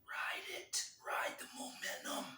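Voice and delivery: breathy whisper. Acted. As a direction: whisper it close to the mic. Whispered sign-off.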